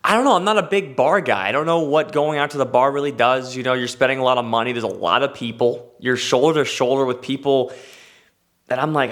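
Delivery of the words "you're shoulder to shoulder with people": ordinary delivery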